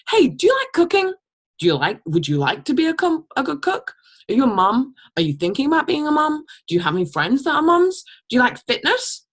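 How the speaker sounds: high eager questioning; eager coaching. Shown as a demonstration. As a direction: pitch it with bright energy. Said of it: "coaching prospect questions".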